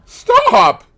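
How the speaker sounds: sudden very high, loud shout; desperate cry. A narrator's reading. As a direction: yell to halt the gunman. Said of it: "he cried".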